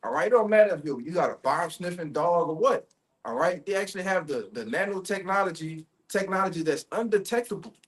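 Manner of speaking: usual speaking voice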